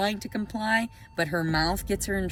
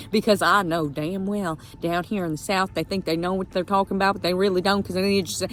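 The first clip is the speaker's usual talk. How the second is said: ordinary talk, nothing marked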